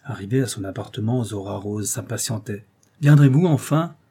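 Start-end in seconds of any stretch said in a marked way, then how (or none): 3.0-4.0 loud, raised impatient call; impatient shout. A narrator's reading. Zora Rose at her apartment calls the dawdlers, shouting impatiently. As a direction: call out, fed up with waiting. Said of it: "cria-t-elle".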